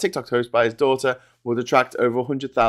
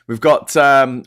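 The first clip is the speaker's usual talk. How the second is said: ordinary talk, nothing marked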